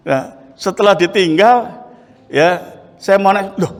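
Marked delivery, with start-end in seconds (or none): none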